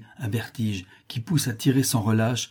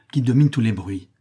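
ordinary delivery

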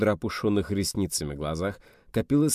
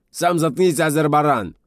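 high, loud, furious yell back; angry shout; 8 semitones higher, 9 dB louder than usual. A narrator's reading. Quarrel between rioting neighbours.

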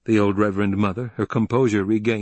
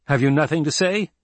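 raised, insistent question; demanding; 7 semitones higher, 4 dB louder than usual. A narrator's reading. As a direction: demand an answer, pitched up. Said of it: "Paul demanded".

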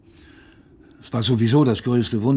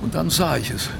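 higher, rising with awe; growing excitement; 5 semitones higher than usual. Acted. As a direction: let the joy build. Narrator sees rescue ship.

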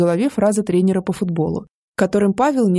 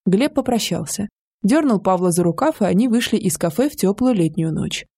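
ordinary delivery, nothing marked

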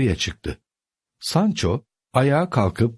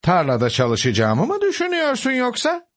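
astonished. A narrator's reading. Uncle hearing he should work the fields, astonished. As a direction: pitch leaping up in disbelief. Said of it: "Don Kişot şaşkındı".

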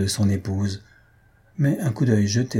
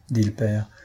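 ordinary delivery, nothing marked

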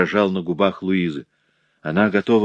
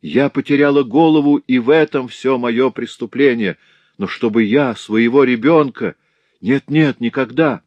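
desperate pleading; 6 semitones higher, 5 dB louder than usual. A narrator's reading. Louise swearing to her father the baby was dead, desperate plea. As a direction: beg them to believe you, near breaking. Daughter pleading innocence, distraught.

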